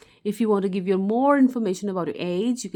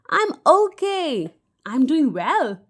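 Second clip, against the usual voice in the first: cheerful reply; 6 semitones higher, 5 dB louder, slower than usual. Shown as a demonstration. Teacher demos cheerful replies.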